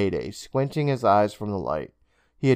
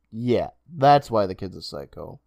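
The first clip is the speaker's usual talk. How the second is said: ordinary delivery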